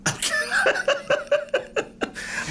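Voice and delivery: breathy laugh that shoots up high; airy high laugh. Real, not acted. Teased as Cousin Brucey, airy high laugh.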